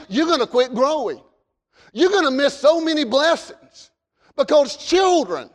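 ordinary delivery

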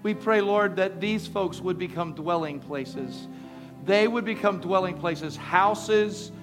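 loud, rising fervent prayer. Real, not acted. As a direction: pray it out, building in intensity. Loud prayer over music.

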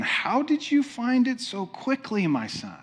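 high-pitched, soft query that drops at the end; acted-out puzzled father, high then falling; puzzled questioning. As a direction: ask it with wondering doubt, starting high. Isaac acted as doubting questioner.

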